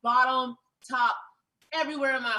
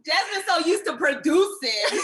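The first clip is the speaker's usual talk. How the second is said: slow and loud, laughing as she teases; teasing laugh; 5 semitones higher, 8 dB louder than usual. Real, not acted. Host teasing cohost about producing, slow laughing tease. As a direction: tease her friend through a laugh.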